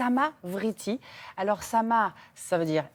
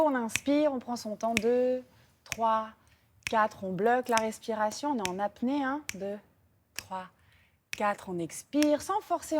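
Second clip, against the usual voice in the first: guided breathing. Acted. Guides square breathing count.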